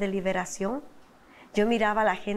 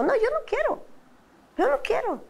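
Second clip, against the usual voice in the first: very high, protesting retold no; adamant refusal. Wife refusing husband's plea to try, high and adamant. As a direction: dig in and refuse, pitch shooting up.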